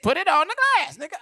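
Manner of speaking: very high, loud order; manic demand. Retold or imitated. Cat demanding the Molly, very high loud manic.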